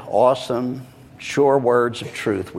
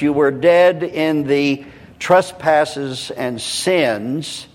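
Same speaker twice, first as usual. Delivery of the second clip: deliberate scripture reading; 3 semitones higher than usual. Real, not acted. Preacher reading Ephesians to the congregation, deliberate and rhythmic. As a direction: read scripture deliberately, stressing key words. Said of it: "very deliberately, rhythmically".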